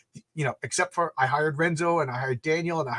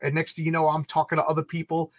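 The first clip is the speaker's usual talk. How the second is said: ordinary talk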